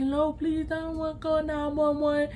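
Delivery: soft, monotone, thick-tongued pleading; imitated slurred friend. Friend refusing to call for help, soft mimicked slur. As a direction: mimic a weak voice with a heavy tongue. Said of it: "this is how she sounds".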